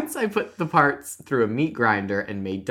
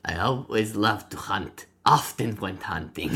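put-on Russian accent, reading a confession. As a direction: attempt a Russian hunter's accent. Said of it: "how to do a Russian accent".

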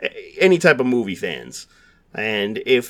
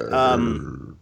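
growl. Real, not acted. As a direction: growl it like death metal. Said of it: "That sounded almost like a growl".

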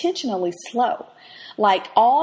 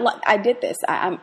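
ordinary talk, nothing marked

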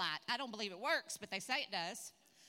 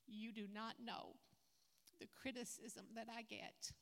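broken voice; 8 dB quieter than usual. Retold or imitated. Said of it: "her broken voice".

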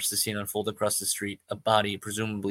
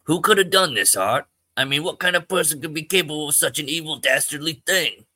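put-on higher and louder, shocked old-timer; small-town man horrified by the killing; alarmed Artie; 6 semitones higher, 7 dB louder than usual. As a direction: gasp it out, appalled at the crime. Artie alarmed at crime scene.

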